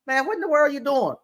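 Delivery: hammed-up reprimand of a worker; mock angry scolding. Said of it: "he made me so mad".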